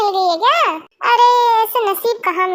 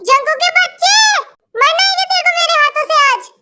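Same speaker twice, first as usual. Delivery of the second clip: imitating angry mom; 6 semitones higher than usual. Brother fooling Jungkook by sounding like their angry mom. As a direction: pass yourself off as the furious mother. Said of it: "नहीं, नहीं mummy".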